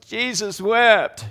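loud, high, drawn-out smart-aleck quote; mock-triumphant, stretched-out and loud; sarcastic cynic. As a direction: blurt it out slowly like the room's cynic. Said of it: "cynic or sarcastic person finally goes".

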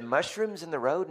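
high-pitched puzzled question; incredulous echo. Retold or imitated. Dad echoing his son's odd answer, incredulous. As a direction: repeat it back in disbelief, pitch lifted.

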